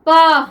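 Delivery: पा said loud with a long open aa; teacher demonstrating the sound पा; model pronunciation. Shown as a demonstration. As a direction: model पा clearly and loudly for students. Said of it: "इसको हम क्या पढ़ेंगे?".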